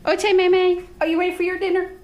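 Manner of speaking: high, loud sing-song call; talking to the dogs. Real, not acted. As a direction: coo to the dogs. Calling dogs to dinner.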